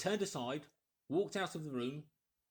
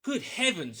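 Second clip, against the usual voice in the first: startled exclamation; 6 semitones higher, 9 dB louder than usual. Acted. Kemp reads the village heading.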